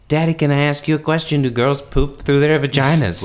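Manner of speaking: put-on high, fast little-kid voice; child voice. Mimicking the boy twin asking his dad, high and quick. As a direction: ask it like an innocent five-year-old. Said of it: "she was like".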